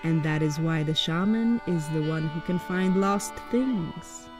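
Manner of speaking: ordinary delivery, nothing marked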